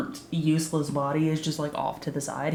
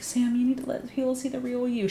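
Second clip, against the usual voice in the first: higher, dreamy mock drugged voice; drugged-up gushing; 6 semitones higher than usual. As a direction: imitate someone sweetly high on drugs. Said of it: "She's high on drugs".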